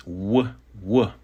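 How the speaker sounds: "w" as a rounded English glide. Shown as a demonstration. Teacher shows the W sound, plain English "w". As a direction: sound out "w" on its own. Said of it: "just as it is in English".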